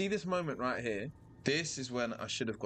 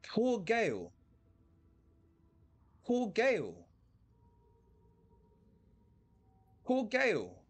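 mock pity; 11 semitones higher than usual. Real, not acted. Mocking pity for dead companion.

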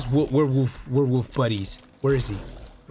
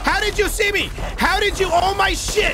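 panicking gamer. Real, not acted. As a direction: blurt it out in sudden panic. Monster spots him suddenly.

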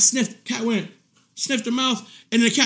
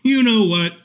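normal talking voice

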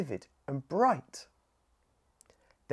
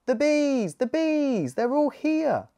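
high, loud happy calling out; excited shout; 10 semitones higher, 4 dB louder than usual. Acted. Scout calling out on finding the bees, high and excited. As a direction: cry out joyfully at finding them. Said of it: "shouted Scout".

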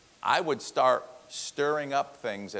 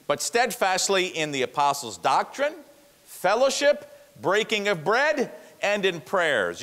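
"steadfastly" hit higher and louder, building list; rising preaching; 9 semitones higher, 6 dB louder than usual. Acted. Preacher driving home the four marks, building louder.

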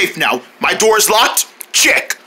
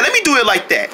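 ordinary delivery

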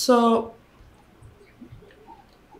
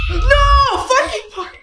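high panicked yell; panicked shout; 13 semitones higher, much slower than usual. Real, not acted. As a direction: shout in sudden fright. Jump scare in horror game.

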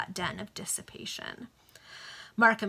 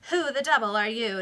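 louder demand, pushed at the start; 3 semitones higher, 6 dB louder than usual. A narrator's reading. Chichester challenges the stranger who greeted him, demanding. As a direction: demand it with some force. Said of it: "demanded Chichester".

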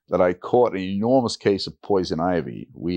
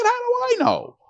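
much higher, incredulous shrug of a question; exasperated doctor. Retold or imitated. Doctor asked how much cash is in the bag, incredulous. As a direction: throw it back as if the question is absurd.

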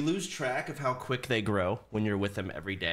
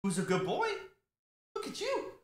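puppy talk; 13 semitones higher than usual. Real, not acted. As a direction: coo sweetly up in the head voice. Cooing to puppy.

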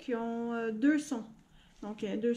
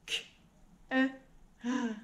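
sounds split one by one; robot voice. Shown as a demonstration. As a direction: segment the word mechanically. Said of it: "on le dit en robot". (voix de robot, sons découpés)

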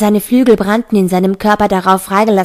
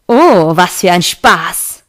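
slow, drawn-out gloating; sardonic, savoured exclamation; mock delight; 2 semitones lower, much slower than usual. A narrator's reading. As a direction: relish it, stretching the words in mockery. Cam gloats mockingly at Daniel.

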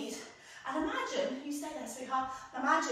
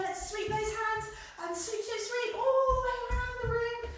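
higher, rising excitement; excited flying; 7 semitones higher than usual. Acted. Teacher urging kids to fly around the room, excited. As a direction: sweep the kids along with glee.

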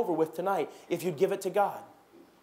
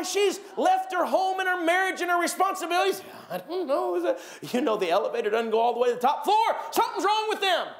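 gossiping churchgoers; 3 semitones higher than usual. Retold or imitated. Churchgoers gossiping about someone who left, high and scandalized. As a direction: ham up shocked busybodies. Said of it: "gossip dialogue acted out".

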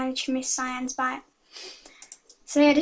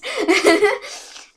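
giggle; 7 semitones higher, 4 dB louder than usual. Real, not acted. Apologising to viewers.

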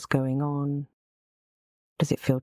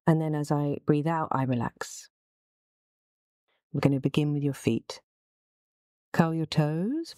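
normal talking voice

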